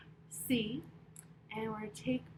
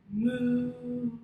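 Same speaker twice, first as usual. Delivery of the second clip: cow moo; 8 dB louder than usual. Shown as a demonstration. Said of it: "We'll say".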